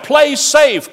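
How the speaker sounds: high, loud triumphant cry; cried out. Acted. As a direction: burst out with the bright idea. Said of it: "cried the Zode".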